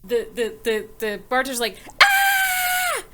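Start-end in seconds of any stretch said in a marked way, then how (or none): 2.0-3.0 high, loud mock scream; frightened scream. Bartender screams at the sight of a ghost, high mock shriek. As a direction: shriek as if fleeing. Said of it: "and runs off screaming".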